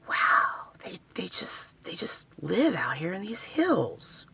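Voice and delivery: hushed, slow, breathless whisper of wonder; awed whisper. Retold or imitated. Vlogger retelling spotting wild horses at night, awed whisper. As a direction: whisper it as if they might hear you. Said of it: "And I'm just like".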